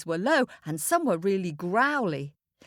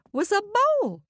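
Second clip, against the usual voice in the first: small voice; 12 semitones higher, much faster than usual. A narrator's reading. Mole voice.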